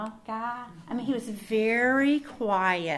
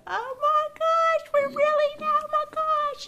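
much higher, slow, squealing oh my gosh; overjoyed disbelief; 6 dB louder, slower than usual. Pretend. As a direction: gasp out the joy of an answered prayer. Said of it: "you're gonna go".

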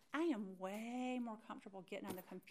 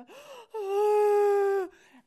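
hammed-up bawl without words; imitated crying breakdown; 11 semitones higher, 19 dB louder than usual. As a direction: gasp into a loud, high wail. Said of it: "I didn't do it until I got to".

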